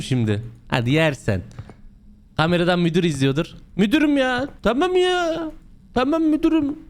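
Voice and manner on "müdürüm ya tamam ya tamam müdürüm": very high whiny protest; mock pleading. Worker to manager watching on camera, mock pleading.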